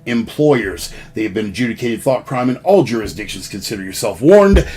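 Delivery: brisk, lightly hammed legal disclaimer read; mock disclaimer. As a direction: rattle off the fine print with a wink.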